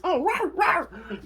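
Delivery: very high-pitched mock roar; squealed, playful creature "rawr"; Lightning roar. Excited Lightning greeting the returning Bakugan, high playful roaring. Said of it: "that was not a bad impression".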